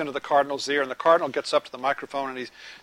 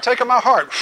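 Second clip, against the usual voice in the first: raised, louder mock plea of the crowd; eager plea; 6 semitones higher, 6 dB louder than usual. Preacher mimicking people pleading with God, eager mock plea. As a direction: beg to be chosen, hammed up.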